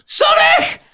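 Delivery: highest, loudest cries, breaking into laughter; screaming for his mate as he slips; retold panicked scream. Falling boy shrieks at laughing best mate, desperate cry. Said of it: "He's scarred".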